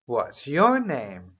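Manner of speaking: slow, clear "What's your name?" with stress on "your"; a falling "What's your name?", each word spaced; slow model question. Shown as a demonstration. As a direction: model it slowly for repeating.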